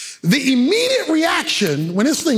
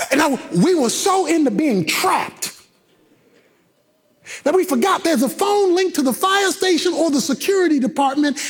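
ordinary talk, nothing marked

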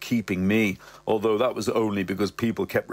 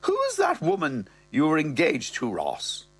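mother's voice; 6 semitones higher than usual. Acted. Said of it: "She's there".

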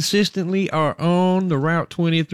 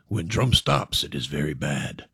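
deep, hushed doom-laden voice, much lower and softer; ominous warning; 11 semitones lower, 5 dB quieter than usual. Acted. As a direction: lower to a grave, ominous hush. Ominous stranger in a joke.

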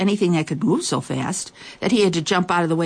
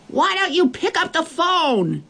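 put-on high child's voice calling far; little girl shouting; 11 semitones higher than usual. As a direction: call from across the street. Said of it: "the little girl shouted even louder".

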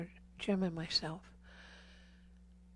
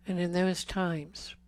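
intimate preaching. Acted. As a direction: preach close to the congregation, dropping to a breath. Preaching to men about abortion.

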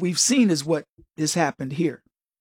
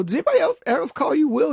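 higher, curious retold question; 7 semitones higher, faster than usual. Retelling asking his friend Willie a question, higher pitch. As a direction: ask it lightly and curiously. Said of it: "I go".

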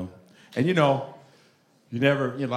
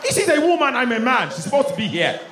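put-on high, loud Nigerian accent, coming down; 10 semitones higher, 5 dB louder than usual. Cousin arguing about women, insistent Nigerian accent.

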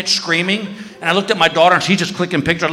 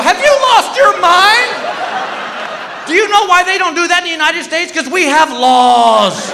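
outraged shout; 12 semitones higher than usual. Retold or imitated. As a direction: blow up at your wife. Husband outraged at shark dive.

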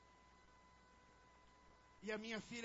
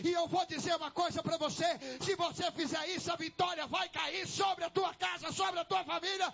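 loud, driving sermon building to a shout; full-force preaching; 9 dB louder, slower than usual. Real, not acted. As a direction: build relentlessly to a shouted, stretched final promise.

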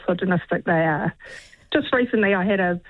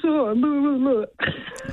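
mock grumbling blah-blah, pitched higher; impersonating partner; 4 semitones higher, much slower than usual. Caller mimics her partner for radio hosts, impersonating partner. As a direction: mimic a partner's whiny protest. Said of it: "doing a wonderful impersonation of him too".

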